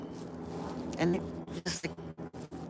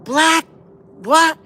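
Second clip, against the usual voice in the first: much higher, louder confused question; puzzled shout; 13 semitones higher, 10 dB louder than usual. Retold or imitated. Misheard from the street, he shouts the title back. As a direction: shout back, not catching it. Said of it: "and I go".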